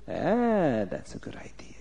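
very soft whispered aside; scheming whisper. Acted. Officer pleased with his plan to lie, scheming whisper. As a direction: whisper it to yourself, plotting.

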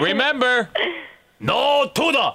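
high-pitched mock-accented sign-off; catchphrase reprise. Caller's parting catchphrase after the reveal.

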